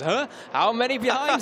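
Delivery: high, loud puzzled rider voice. Retold or imitated. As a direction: act out the rider looking back confused. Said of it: "and he goes".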